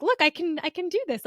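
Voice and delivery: lightly hammed-up look what I can do; mock proud excitement. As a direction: chirp it like a kid showing off. Retelling her own proud moment.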